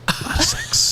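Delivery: high breathy repeat of a lot of sex; a lot of sex squeaked high and soft; high whisper. Pretend.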